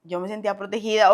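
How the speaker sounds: ordinary delivery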